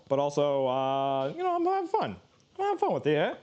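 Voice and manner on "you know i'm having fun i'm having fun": playful high voice. Acted.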